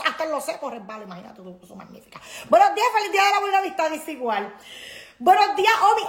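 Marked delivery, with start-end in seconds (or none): 2.5-3.9 cheerful greeting. Real, not acted. Greeting live viewers. 5.2-6.0 cheery greeting. Real, not acted. Greeting live viewers.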